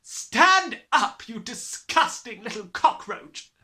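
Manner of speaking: loud, barked order; furious command. A narrator's reading. Headmistress ordering Matilda up, barked loud. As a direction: bark the order.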